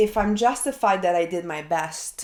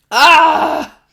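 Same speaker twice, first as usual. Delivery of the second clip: a sharp high ah, much louder; loud release cry; 8 semitones higher, 15 dB louder than usual. Real, not acted. Vlogger releasing discomfort, loud high ah. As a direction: let the tension burst out. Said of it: "A lot of visceral expression".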